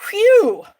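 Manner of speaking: loud, high burst of relief; sharp relieved exhale, loud and high; relieved whew. Real, not acted. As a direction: blow out a big whew of relief. Relief after fixing mistake.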